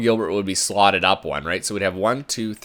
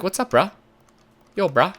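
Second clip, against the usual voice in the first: higher-pitched mock bro voice; lightly voiced bro dog, pitched up; 7 semitones higher than usual. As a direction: voice the dog greeting you like a bro. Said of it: "He's like".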